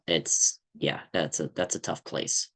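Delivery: ordinary talk, nothing marked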